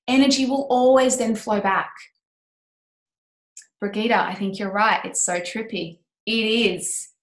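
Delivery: ordinary talk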